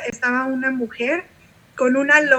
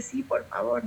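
tearful quiet reply; 11 dB quieter than usual. Retold or imitated. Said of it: "empieza a llorar y me dice".

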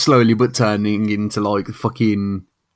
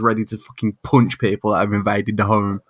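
ordinary talk, nothing marked

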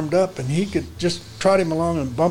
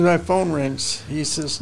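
ordinary talk

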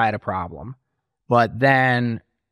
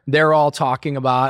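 usual speaking voice

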